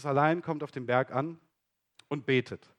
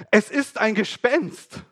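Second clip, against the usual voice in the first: slow, loud, higher cry of alarm; frightened cry; 6 semitones higher, 6 dB louder, slower than usual. Acted. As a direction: cry out in terror at a ghost. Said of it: "wurden sie bestürzt und sprachen".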